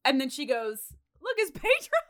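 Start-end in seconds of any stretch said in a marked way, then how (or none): 1.2-2.0 put-on high-pitched gleeful child; mock little girl. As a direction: squeak it like a child spotting a pet. Quoting girl spotting parrot.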